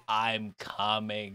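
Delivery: slow, quiet, flat-affect imitation of the cartoon line; deadpan. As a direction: drop all emotion and drag it out low. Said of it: "Just the deadpan".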